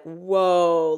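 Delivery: a loud, woozy drawn-out whoa; loopy whoa. Retold or imitated. A meditator reeling at the shouting, loud and loopy. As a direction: sound dazed and spaced out. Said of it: "like, loopy".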